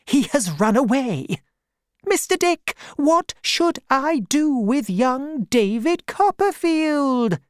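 aunt consulting Mister Dick; aunt asking advice. Acted. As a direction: ask his counsel seriously.

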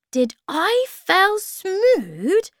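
very high, slow squeak; drawn-out little creature voice; tiny creature. A narrator's reading. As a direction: squeak the question out slowly and loudly. Tiny Bob asks about food.

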